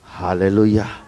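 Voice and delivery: usual speaking voice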